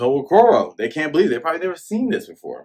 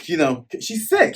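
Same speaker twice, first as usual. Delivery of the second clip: normal talking voice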